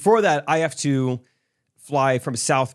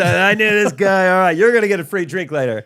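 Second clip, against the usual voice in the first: high, loud announcer voice; showy flight attendant; 7 semitones higher, 7 dB louder than usual. Retold or imitated. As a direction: boom like a cheesy host.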